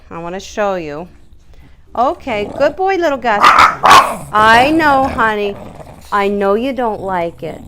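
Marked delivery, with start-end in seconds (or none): 1.9-7.6 louder, high, reassuring dog over his howling; calming a screaming dog, louder and high; loud soothing over cries. Real, not acted. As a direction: soothe him loudly above the noise. Dog cries during nail trim.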